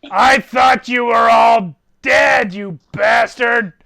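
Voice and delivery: slow, loud, furious otherworldly voice; angry demon lord. Acted. GM voicing Abraxiel, roaring at Ramakan for being late. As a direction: Abraxiel bellowing at the late hero.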